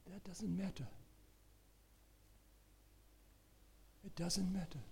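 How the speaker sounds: very soft, near-whispered comfort; soft preacher's murmur after a long pause; hushed reassurance. Real, not acted. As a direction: reassure them in a hush.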